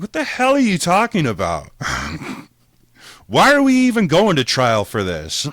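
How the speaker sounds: loud, high, incredulous shouting; outraged yell. Retold or imitated. Yelling at his lawyer over the absurd charge, outraged. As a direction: blow up at her in disbelief. Yelling at public defender, disbelief.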